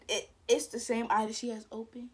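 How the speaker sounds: usual speaking voice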